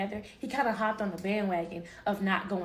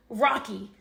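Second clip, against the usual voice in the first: usual speaking voice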